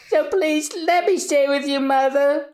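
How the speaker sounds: high crying voice; tearful begging. Acted. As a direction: beg through tears. Tearful reunion.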